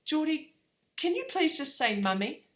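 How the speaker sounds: put-on high-pitched coaxing request to a toddler; retold plea. Mum asking her son to say mummy, high coaxing plea. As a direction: lift the pitch and coax a small child. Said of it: "I looked him in the eye and I said".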